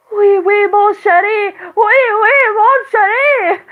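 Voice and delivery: flustered repeating. Retold or imitated. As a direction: squeal it back, all flustered. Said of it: "I kept repeating what he says".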